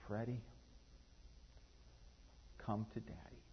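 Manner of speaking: gentle call. Retold or imitated. New father softly coaxing his son, hushed and tender. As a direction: kneel and coax him softly.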